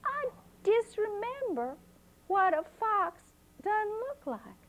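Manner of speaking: put-on high, bright-eyed child asking; curious little girl, voiced high. A narrator's reading. Flossie talks to grandma.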